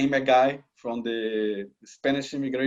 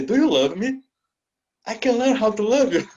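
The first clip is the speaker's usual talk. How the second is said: higher, mock pleading question; a hammed-up higher retelling of his answer; mock earnest reply; 8 semitones higher than usual. Retelling his answer to the proposal, in a higher mock-earnest voice.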